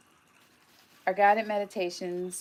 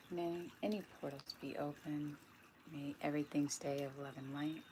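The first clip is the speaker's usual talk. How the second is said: soft, hushed invocation; quiet prayer; 8 dB quieter than usual. Real, not acted. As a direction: softly ask for protection, letting the voice sink. Invocation to cleanse the space.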